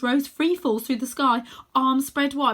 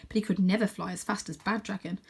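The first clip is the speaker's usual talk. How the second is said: ordinary delivery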